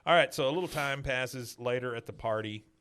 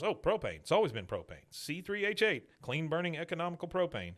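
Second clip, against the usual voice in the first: higher-pitched automatic recital; acted-out knee-jerk answer, pitched up; reflexive reply; 6 semitones higher than usual. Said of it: "you know, just reflex".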